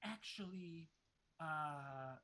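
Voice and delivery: a hint of a sly, eager host; Moorcroft's voice. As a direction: offer it with hidden hunger. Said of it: "he's, like, looking out at all the bodies".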